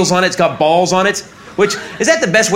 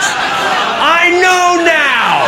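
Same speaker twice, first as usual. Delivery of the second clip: dragged-out shout; 9 semitones higher, much slower than usual. Real, not acted. Comic yelling the punchline about the baby. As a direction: stretch it out and shout.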